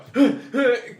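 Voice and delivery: loud acted gasp; exaggerated gasp. Reenacting a tired late-night film take, exaggerated gasping. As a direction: gasp as if winded mid-take. Acting out being exhausted on set.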